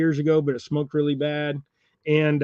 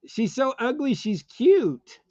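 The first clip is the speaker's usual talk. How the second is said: put-on baby talk; 8 semitones higher, slower than usual. About the toy rat.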